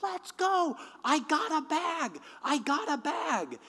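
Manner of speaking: very high, put-on woman's holler; yelling woman. Woman pulls up fast in a car, high yelling. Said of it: "as she's yelling".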